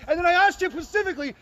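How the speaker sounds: ordinary talk, nothing marked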